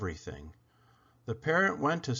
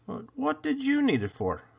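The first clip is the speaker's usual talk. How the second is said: higher, lightly voiced young boy; a shy boy, pitched up; boy's voice; 12 semitones higher than usual. A narrator's reading. Boy answers Isabel about his box, higher boy voice.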